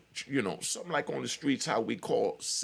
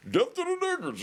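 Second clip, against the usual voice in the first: David Chappelle voice; 3 dB louder than usual. Retold or imitated. Mocking drunk skinheads on the yard, hammed-up comic impression. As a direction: play the yard chant like a Chappelle sketch character. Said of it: "David Chappelle voice".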